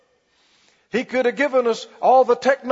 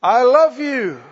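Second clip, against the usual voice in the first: louder, slow "I love you" called down from above; mock yell from heaven; 4 semitones higher, 6 dB louder, slower than usual. Preacher mocking God yelling from heaven, a mild loud call. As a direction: shout it down from heaven like a proclamation. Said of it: "that he yelled from heaven".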